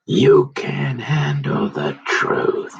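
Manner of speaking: slow, drawn-out dramatic movie line; Nicholson impression. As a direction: lean into the line like the courtroom scene. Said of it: "Nicholson and Cruz, huh?".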